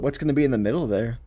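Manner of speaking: ordinary delivery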